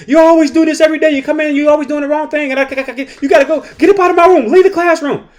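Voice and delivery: a teacher losing it completely, voice way up; mock screaming teacher. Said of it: "scream at the student and say".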